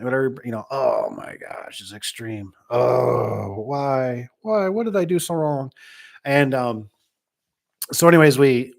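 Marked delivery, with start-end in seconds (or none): 0.7-5.7 mock despair. Mock lament over broken opener.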